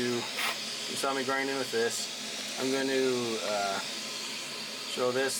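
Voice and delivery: talking up over the machine noise; over vacuum noise. Real, not acted. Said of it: "you'll have to excuse the vacuum noise".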